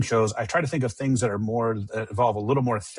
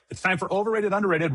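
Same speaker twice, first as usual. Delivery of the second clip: announcer voice; 8 semitones higher than usual. Acted. Announcing the segment.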